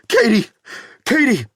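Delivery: high, loud anguished calls; frantic father calling out; desperate calling. A narrator's reading. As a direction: scream her name to wake her. Dave calling unconscious daughter.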